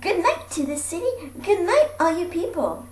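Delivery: plain reading voice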